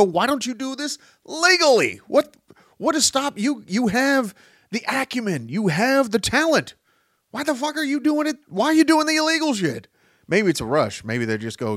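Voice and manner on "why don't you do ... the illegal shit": exasperated and much higher, fast by the end; exasperated mock rant. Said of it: "You sit there and go".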